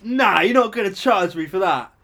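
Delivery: retold outrage; imitated indignant customer. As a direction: act out an entitled protest. Retold customer refusing to pay.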